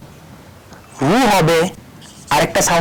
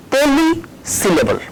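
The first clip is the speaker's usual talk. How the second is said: higher, slowly drawn-out term; slow announcing; 8 semitones higher, much slower than usual. Shown as a demonstration. Teacher announcing a new term slowly to the class. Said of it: "last কাইন্ডটা আছে".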